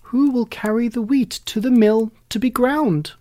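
high, loud hen call. A narrator's reading. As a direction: call the animals to help. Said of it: "she called out".

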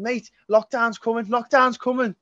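high, mock-urgent Scouse warning; a hint of a panicky Scouse lad; Scouse mate warning of lockdown, high and urgent; Scouse accent. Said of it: "I'm not gonna do a Scouse accent".

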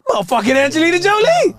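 loud, high starstruck yell; excited shout. Retold or imitated. Retelling seeing Angelina Jolie, a loud starstruck shout. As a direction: shout it like spotting a celebrity. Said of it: "I was like".

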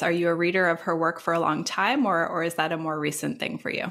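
ordinary talk, nothing marked

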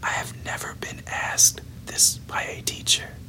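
ordinary delivery, nothing marked

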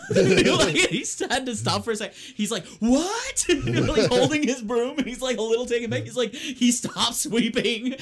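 high, loud laughter breaking up the words; can't stop laughing while telling it; laughing through it. Real, not acted. Telling friends about Gabe's stunned reaction, laughing hard.

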